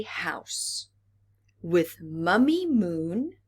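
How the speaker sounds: put-on slow, careful read-aloud for children; storybook narration. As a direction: read it slowly to little listeners. Reads picture book aloud.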